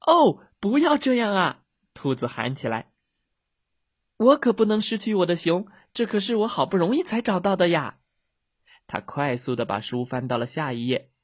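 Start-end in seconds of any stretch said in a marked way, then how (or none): 0.1-8.0 high, loud wail of dismay; dismayed shout. A narrator's reading. Rabbit cries out as his bear walks away, loud dismay. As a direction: cry out at losing the bear.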